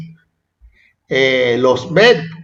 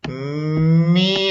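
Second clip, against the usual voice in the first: "Mi" held long on the I; stretched accented syllable; 3 dB quieter than usual. Shown as a demonstration. Teacher showing which vowel takes the accent, long "Mi". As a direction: hum into "Mi" and hold it. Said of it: "se lleva acento la I".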